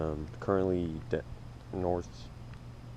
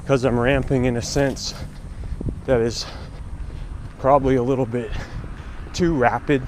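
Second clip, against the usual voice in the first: while running. Real, not acted. Check-in at mile three.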